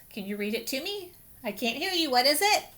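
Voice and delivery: ordinary talk, nothing marked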